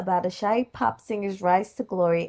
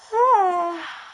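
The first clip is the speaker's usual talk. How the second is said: tired sigh; 10 semitones higher, 4 dB louder than usual. Real, not acted. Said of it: "long day for me".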